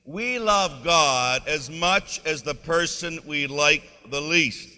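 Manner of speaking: loud, slow and deliberate chant-along; congregational recitation. Shown as a demonstration. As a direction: spread the words out so everyone can join in. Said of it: "say that again".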